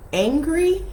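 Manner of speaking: angry thrown out high and loud; stress on angry. Real, not acted. Stressing the word she rejects.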